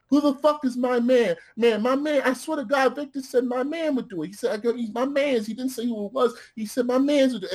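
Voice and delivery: high, frantic rambling excuse; frantic pleading. Retold or imitated. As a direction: babble it high and desperate, swearing to God.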